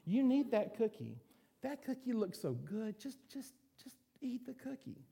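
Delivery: high, coaxing tempter voice, fading to a hush; tempting little voice. Retold or imitated. A preacher voicing the temptation to eat the last cookie, high and coaxing. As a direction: coax yourself toward the cookie in a high, sly little voice. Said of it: "all these little voices".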